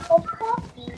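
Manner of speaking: high startled laughing shout; shrieking laugh. Real, not acted. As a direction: burst out laughing in fright. Shouting laugh at game scare.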